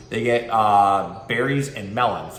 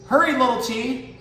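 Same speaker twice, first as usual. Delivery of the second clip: high, louder teasing call; cheetah calling; 13 semitones higher, 3 dB louder than usual. Acted. Cheetah teases little tortoise in a higher teasing voice. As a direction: tease the slowpoke as you pass.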